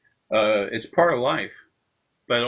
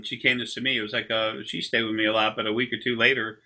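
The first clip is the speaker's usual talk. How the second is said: ordinary delivery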